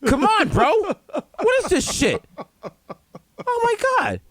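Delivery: high, exasperated mock outrage, pitch soaring; outraged fan. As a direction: whine at the clerk like it's a personal insult. Acting outraged Yankee fan in store.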